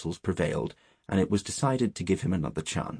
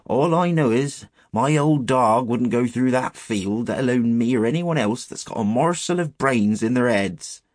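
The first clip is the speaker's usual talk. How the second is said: higher, louder rustic shepherd voice; old country shepherd; 5 semitones higher, 6 dB louder than usual. Acted. Shepherd answering boys about the clump, rustic and emphatic.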